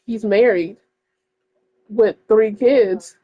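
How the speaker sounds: usual speaking voice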